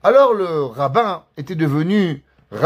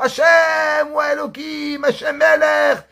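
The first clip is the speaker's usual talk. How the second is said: shouted prayer; 10 semitones higher, slower than usual. Retold or imitated. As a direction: cry out the prayer to heaven. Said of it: "tout le monde qui crie fort".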